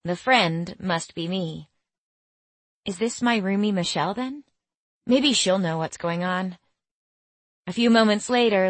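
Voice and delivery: plain reading voice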